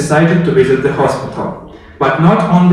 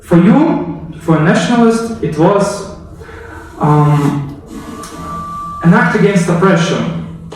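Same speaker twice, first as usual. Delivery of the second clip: raised, urgent history narration; dramatic storytelling; 4 semitones higher than usual. A narrator's reading. History teacher pulling viewers into the assassination, raised dramatic narration. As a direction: build the reveal like a storyteller.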